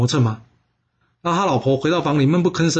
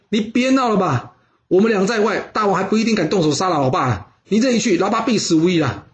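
higher-pitched urgent arguing; acted brotherly remonstrance, insistent and raised; exasperated protest; 6 semitones higher than usual. A narrator's reading. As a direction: talk him out of it, pitched up. Brothers argue over going to court.

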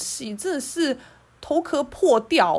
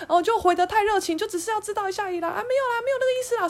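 mocking, high, fast voice with a nervous laugh; flustered backpedaling colleague; 7 semitones higher, faster than usual. Mocking the caught-out colleague, high and flustered. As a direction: ham up her panicky excuses. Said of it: "然后他吓死，他就说".

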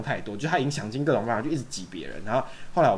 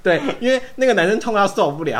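laughing; 9 semitones higher, 7 dB louder than usual. Real, not acted. Laughing retelling.

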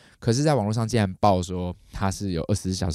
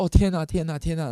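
high-pitched flustered exclamations; retold panic, repeated in a raised voice; panicked; 8 semitones higher, 4 dB louder than usual. Owner alone at home with sick dog, panicked. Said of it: "我就整个在家里慌张".

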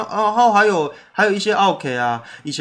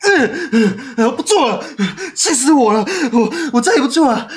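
higher and louder, close to tears; fed-up outburst; 7 semitones higher, 3 dB louder than usual. Retold or imitated. Fed-up son swearing to quit the shop, higher, teary outburst. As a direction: blow up like you're done with it all. Venting anger about quitting.

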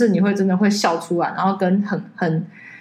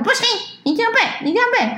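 hammed-up insistent mother, much higher; strict mom; 13 semitones higher, much faster than usual. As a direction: mimic a mom laying down the law she'll drop later. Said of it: "在前面说". (学妈妈假装严厉)

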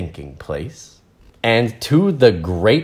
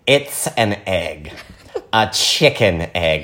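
smug know-it-all. Acted. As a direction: spell it out as if everyone should know. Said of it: "that voice".

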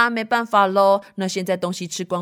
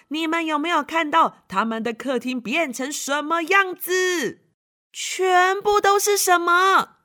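excited exclaim; 7 semitones higher than usual. Acted. Storyteller exclaiming to children.

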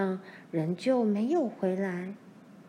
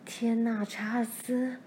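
gasp; 2 semitones higher than usual. A narrator's reading. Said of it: "妈喘了一口气说".

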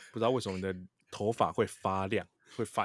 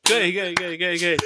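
excited approval; 10 semitones higher, 10 dB louder, much faster than usual. Real, not acted. Loud delighted approval.

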